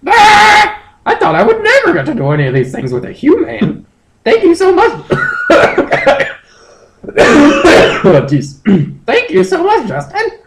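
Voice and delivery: very high, gushing falsetto; squeaky creature thanking with glee; high excited Moltres voice. Acted. Moltres praising Justin to his boss, high and excited.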